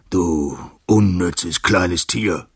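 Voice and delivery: loud, slow lion's bellow; angry roar. A narrator's reading. Furious lion to the little mouse that woke him, roaring. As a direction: roar it slowly, drawing out the insult. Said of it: "brüllte er".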